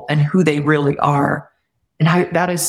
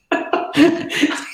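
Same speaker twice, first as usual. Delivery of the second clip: delighted laugh; 12 semitones higher than usual. Real, not acted. Laughing at the coincidence.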